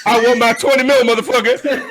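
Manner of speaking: hammed-up demand for his money; mock demanding athlete. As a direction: Dak Prescott demanding his pay, hammed-up and laughing. Imagined Dak Prescott demanding pay.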